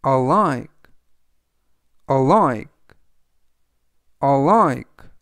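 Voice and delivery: "alike" stressed on LIKE, a-LIKE, said loudly; teacher models "alike" with emphasis; model pronunciation. Shown as a demonstration. Teacher calls out "alike" for students, loud and crisp. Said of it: "라고 발음하시면 되겠습니다".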